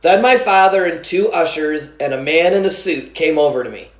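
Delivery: usual speaking voice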